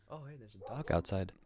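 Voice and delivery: hushed whisper about a dog. Real, not acted. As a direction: whisper it so the dog won't hear.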